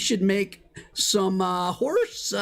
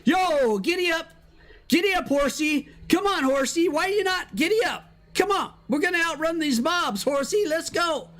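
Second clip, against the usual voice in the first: high, playful cowboy calls; urging a horse on; 8 semitones higher than usual. Pretend. Rider outrunning mobs on his horsey, urging a horse on. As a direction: egg the horse on, pitched well up.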